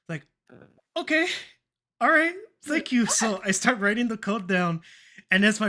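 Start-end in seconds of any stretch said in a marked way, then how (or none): 1.0-3.0 high, loud delighted thanks; excited thanks. Retold or imitated. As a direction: burst out with surprised joy at getting it. Retold delighted reply getting code.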